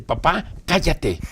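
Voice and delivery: mock bold little girl; girl talking back. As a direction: playfully tell dad to shut up. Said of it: "Una sonrisa y me dice".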